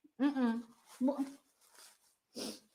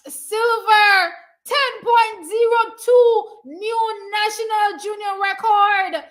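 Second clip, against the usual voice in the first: crying; 7 semitones higher, 5 dB louder, slower than usual. Real, not acted. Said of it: "I'm so emotional".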